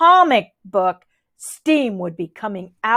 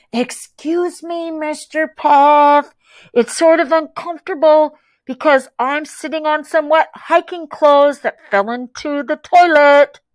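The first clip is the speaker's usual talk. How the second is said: put-on small muffled boy; 5 semitones higher, 4 dB louder than usual. A narrator's reading. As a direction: plead meekly from the hamper. Muffled voice from the hamper.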